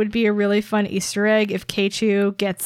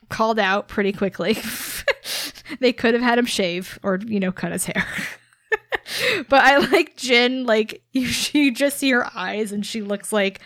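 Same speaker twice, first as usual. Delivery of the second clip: ordinary delivery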